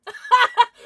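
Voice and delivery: loud, high burst of laughter; shrieking laugh of disbelief; excited laugh. Real, not acted. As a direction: laugh out at top volume. Bursts out at the card pulled.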